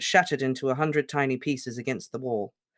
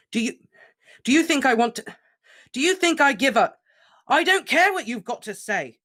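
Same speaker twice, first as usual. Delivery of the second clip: much higher and louder roar; furious stammering roar; 11 semitones higher, 7 dB louder than usual. Acted. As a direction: roar over him. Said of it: "Harry roared".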